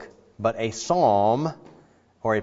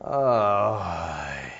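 grieving oi; 3 semitones lower than usual. Acted. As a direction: sigh it like a grieved father. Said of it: "he's saying".

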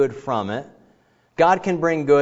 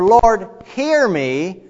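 slow, louder, higher plea; drawn-out plea; 7 semitones higher, 6 dB louder, much slower than usual. Shown as a demonstration. Psalmist pleading with the Lord, slow and loud. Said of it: "start out with the word".

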